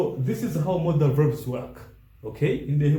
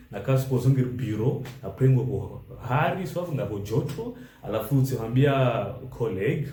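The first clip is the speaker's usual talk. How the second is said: normal talking voice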